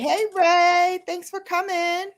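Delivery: high cheerful shout-out to a viewer; high greeting. Real, not acted. Host greets a viewer in chat with a high hello. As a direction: lift up to welcome Ray.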